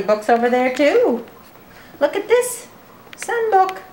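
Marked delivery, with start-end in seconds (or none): 2.0-3.8 excited high voice. Acted.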